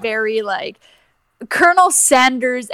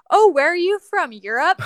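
higher, louder nosy local; curious American stranger; 7 semitones higher, 4 dB louder than usual. Retold or imitated. Vegas locals questioning visiting Canadians, mock curious. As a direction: imitate a puzzled tourist-strip local. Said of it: "they looked at us and you're like".

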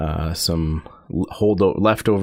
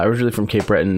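normal talking voice

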